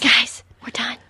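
a whispered aside; whispering. Real, not acted. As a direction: breathe it with no voice. Quietly signals end of show.

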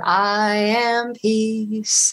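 very slow, calm intoning; slow meditative mantra; mantra demo. Shown as a demonstration. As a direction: say it like a chant. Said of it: "You could choose an English word".

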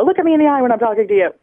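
mock a mother telling off her kid; stern mother. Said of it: "our mothers would always say".

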